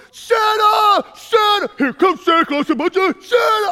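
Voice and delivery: high loud yelling at the parade; hollering up a light pole for Santa; screaming. Retold or imitated. Man in a suit screams for Santa from a pole. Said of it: "screaming at the top of his lungs".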